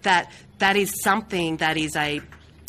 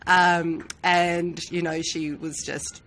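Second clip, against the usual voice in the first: usual speaking voice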